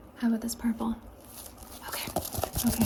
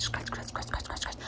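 ordinary talk

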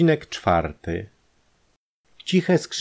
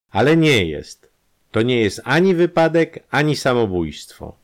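much higher and louder, stressing both ani; firm doctor; 7 semitones higher, 6 dB louder than usual. A narrator's reading. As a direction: flatly rule it out. Doctor firmly contradicts.

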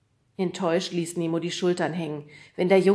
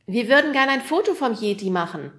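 put-on higher, louder boy's call upward; 6 semitones higher, 8 dB louder than usual. A narrator's reading. Fred calling up to the man on the roof, loud.

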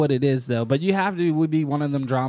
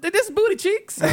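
mock quote. Quoting a favorite YouTube clip.

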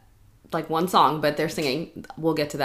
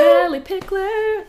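excited announcer; 12 semitones higher, 7 dB louder, slower than usual. Pretend.

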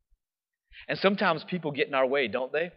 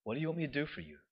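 softer, gentle asking; soft question; 11 dB quieter, much faster than usual. Retold or imitated. Jesus softly asking the blind man. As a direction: ask it like a quiet invitation.

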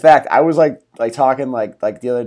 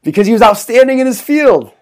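higher, louder cheesy punchline; punchline delivery; 9 semitones higher, 4 dB louder than usual. Acted. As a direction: deliver the dad joke with glee. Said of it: "dad joke punchline".

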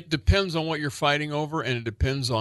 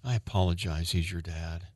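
low, flat, grudging apology; 6 semitones lower, 5 dB quieter than usual. Pretend. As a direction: apologize through gritted teeth. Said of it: "Not like that".

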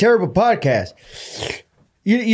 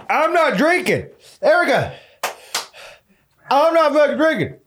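pained yelling, pitched high; stubborn shouting refusal; defiant shout; 9 semitones higher than usual. Real, not acted. Refusing water, shouting in pain.

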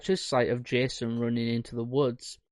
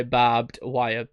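ordinary talk, nothing marked

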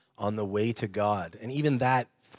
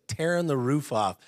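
normal talking voice

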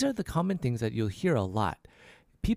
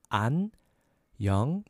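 안녕하세요 slowly, one separated syllable at a time; slow syllable breakdown; faster than usual. Shown as a demonstration. Teacher breaking down the formal hello, slow syllables. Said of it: "A little faster".

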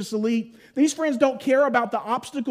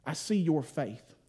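tender Jesus; 7 semitones lower, 9 dB quieter than usual. Retold or imitated. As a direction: speak gently to the friends, almost hushed. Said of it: "he looks at the friends and he says".

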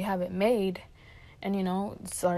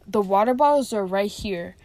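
imitating an angry boss, much louder; pissed off; 9 dB louder than usual. Shift leader scolding worker over water bottles, pissed off. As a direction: scold loudly, building in force. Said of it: "because she was pissed off".

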